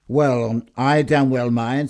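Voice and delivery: loud, slow, indignant objection; a husband refusing the customer's kiss, indignant and loud. Acted. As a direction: drag it out, offended. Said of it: "said Mister Edison".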